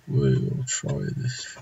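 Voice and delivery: slow, hushed mock-epic whisper; dramatic whisper. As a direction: whisper it like a trailer voice.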